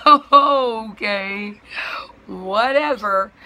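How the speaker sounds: high, loud, exaggerated whatever; mock-agreeing, drawn-out brush-off; retold dismissal. Customer giving up on arguing dispatcher, high sarcastic dismissal. Acted dismissive reply in quarrel.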